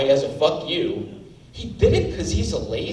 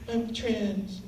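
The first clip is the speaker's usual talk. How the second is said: breathy, much softer mock Eddie Redmayne line; Eddie Redmayne impression; 2 semitones higher, 13 dB quieter than usual. Comic mocking the actor's performance, much softer. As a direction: mimic the actor's feeble voice, mocking. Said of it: "That was literally his entire performance".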